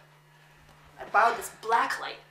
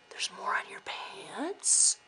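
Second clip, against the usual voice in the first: slow horrified whisper; 3 semitones lower, 7 dB quieter, slower than usual. Real, not acted. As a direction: breathe it out in horror.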